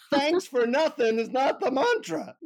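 high, emphatic guru rebuke; scolding guru. Retold or imitated. Guru rejects the traveler's sarcastic thanks, scolding high. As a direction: imitate an indignant guru correcting a pupil. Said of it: "he looks at me and he says".

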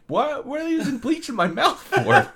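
high, incredulous Texan-cartoon squawk; Hank Hill. Patient asks about bleach in his mouth, Hank Hill-like outrage. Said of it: "that sounded like Hank Hill".

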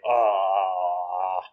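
long, low drawn-out "ah"; baffled groan. Retold or imitated. Imitating the officer stumped by fast English, long low groan.

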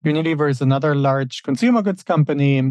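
ordinary delivery

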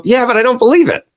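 high, sheepish admission; a doubtful attendee pitched high; audience member. Retold or imitated. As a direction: attendee confessing disbelief in a high voice. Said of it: "And they go".

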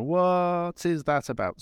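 mock confusion of a listener; drawn-out bewildered what is that about; puzzled listener. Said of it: "listening to this podcast and go".

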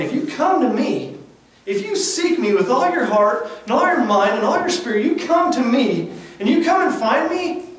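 high and loud, promising; fervent preaching. Acted. Preacher gives God's answer to the believer, fervent preaching.

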